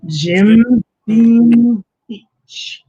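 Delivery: loud, very slow and stretched; drawn-out reveal. Real, not acted. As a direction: drag out the name for effect. Revealing the alcohol.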